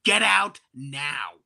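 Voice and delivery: slow, stretched mock command, half-shouted; mock order to leave. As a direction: pretend to throw the attendant out, dragging the words. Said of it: "I wanna be like".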